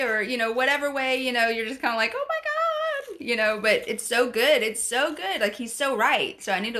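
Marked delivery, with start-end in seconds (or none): 2.2-3.2 high, slow exasperated squeal; mock exasperation. Wife reacting to her husband's instructions, mock-exasperated squeal. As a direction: stretch it out high and thin in mock overwhelm.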